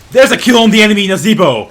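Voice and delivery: very loud, high announcement; excited kill call. Real, not acted. Streamer announcing a kill on the enemy, excited shout.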